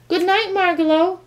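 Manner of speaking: high, loud goodnight called across the room; calling out. A narrator's reading. Stuart calls goodnight loudly to the departing bird. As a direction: call after her as she leaves. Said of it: "called Stuart".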